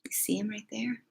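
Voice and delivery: soft unvoiced whisper. Real, not acted. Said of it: "whisper to listeners".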